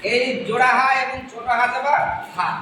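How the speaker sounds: ordinary talk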